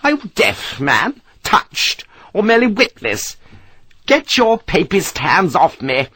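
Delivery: very high, indignant scolding; haughty outrage, pitched far up; furious matron. Acted. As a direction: she wants to wring his neck. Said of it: "as though she would wring his neck".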